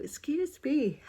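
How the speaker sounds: words stretched through a yawn; a yawn breaking into the apology. Real, not acted. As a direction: say it mid-yawn. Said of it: "then I start yawning".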